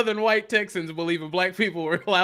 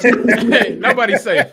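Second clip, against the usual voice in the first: louder, laughing through it; laughing boast; 6 semitones higher, 9 dB louder, slower than usual. Real, not acted. Host laughing at viewers roasting the super chat. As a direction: boast while cracking up.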